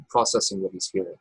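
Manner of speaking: normal talking voice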